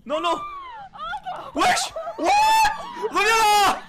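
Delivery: panicked high shouting; scared horror game streamer. Real, not acted. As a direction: yell in a fright at the monster. Panicked shouting at fleeing monster.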